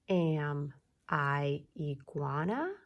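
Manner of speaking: slow, word-by-word, climbing at the end of "iguana"; rising question. Shown as a demonstration. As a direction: keep "Am I" low, then lift "iguana". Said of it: "so we're asking questions".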